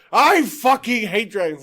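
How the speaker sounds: hammed-up dragon-hater outburst; mock furious declaration. Player imitating the dragon-hating paladin, mock fury.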